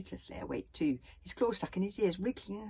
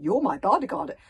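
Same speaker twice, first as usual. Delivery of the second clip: louder, disbelieving question; stunned he was the target all along; astonished; 2 semitones higher, 15 dB louder than usual. A narrator's reading. Hiccup realising his bodyguard tried to kill him, astonished. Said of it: "said Hiccup in astonishment".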